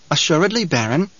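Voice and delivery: lilting, musical henchman voice; a light touch of honeyed tenor; sweet tenor. A narrator's reading. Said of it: "His voice came out tenor with a sweet musical quality".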